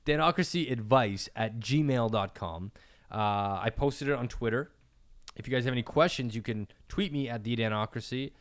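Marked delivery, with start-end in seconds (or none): 0.1-2.7 slow and clear, every syllable; slow, careful spelling. Shown as a demonstration. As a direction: read the address slowly, cleanly. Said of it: "Let me try that again".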